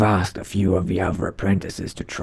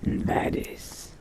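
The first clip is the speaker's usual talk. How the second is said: lower, hushed aside to oneself; vampire musing to self; 6 semitones lower, 7 dB quieter than usual. Acted. Vampire apprentice wondering about stepping into sunlight, quiet musing. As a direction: wonder quietly, half under your breath.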